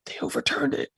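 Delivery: high breathless imitation of her sobbing; mock sobbing wife. Husband imitating his sobbing wife's news, high and breathy. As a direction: echo his wife's tearful words, voice cracking high. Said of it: "And she's sobbing".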